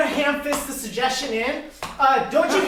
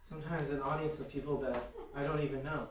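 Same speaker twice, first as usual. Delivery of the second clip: inner monologue; 9 semitones lower, faster than usual. Acted. Improviser realizing their need for approval, hushed inner monologue.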